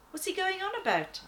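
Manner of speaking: baffled by the noise above; puzzled. Acted. Lion asking the angel about the king, high and puzzled.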